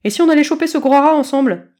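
rally the gang; eager kitten. A narrator's reading. Kitten proposes to his friends, loud.

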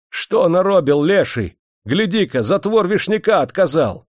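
high-pitched, angry accusation; hostile outcry. A narrator's reading. Angry workers turning on the dam master, hostile outcry. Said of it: "Они злобились, враждебно".

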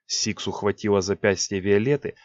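ordinary delivery

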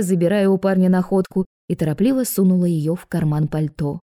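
usual speaking voice

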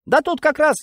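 very high, fussing reply; high-pitched overeager helpfulness; flustered eagerness. Acted. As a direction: scramble to oblige a superior. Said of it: "засуетился старшина, от старания заговорил сбивчиво".

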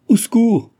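loud, high-pitched scream for rescue; cry for help. A narrator's reading. Young woman seized by her persecutor, crying for help. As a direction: shout out for help, voice leaping up.

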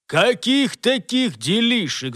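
loud, slow indignant demand; bristling anger. Acted. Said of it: "взъерепенился басистый работник".

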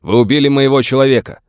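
loud, high accusing yell; shouted accusation. A narrator's reading. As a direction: hurl the charge across open ground. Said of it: "крикнул в ответ Хопкинс".

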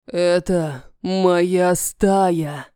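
low, slow sepulchral voice; ghost story. Acted. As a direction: speak like a voice from the grave. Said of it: "Раздаётся низкий жуткий голос. Загробный, утробный".

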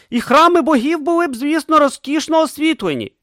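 high, excited inventor voice; eager young inventor. A narrator's reading. Urn dreaming of taming lightning, high and eager. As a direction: dream out loud, high and bright. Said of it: "поспіхом додав Урн".

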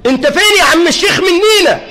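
high shouted reproach, imitating a complainer; mock shouted reproach. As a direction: hurl it at the sheikh, mock anger. People blaming the sheikh. (عتاب صارخ بتقليد ساخر)

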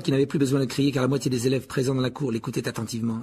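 ordinary delivery

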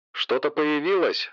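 quiet, distant headset voice; like over a radio headset; through headphones. Acted. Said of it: "в наушниках голос учёного".

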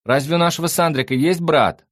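high, loud shouted question; shouting. A narrator's reading. The fox questions her friend in another room, shouting high. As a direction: holler the question across the flat. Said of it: "закричала лиса".

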